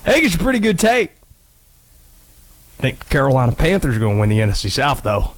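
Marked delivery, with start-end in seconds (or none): 2.8-5.3 put-on Southern accent. Host plays Conspiracy Josh in a Southern accent. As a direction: put on a thick Southern accent, low and quick. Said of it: "Listen to this accent, brother".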